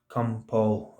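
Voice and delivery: lightly imitated voice, low and soft; quietly beckon, pitched a little down; mild, hushed retelling of a summons; hallucinated voice calling. Imagined voice calls him again.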